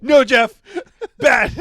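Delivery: loud, high laughing scold like to a dog; mock scolding. Comedian scolding Jeff like a dog, loud. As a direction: bark it at him like a naughty puppy. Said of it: "he's like".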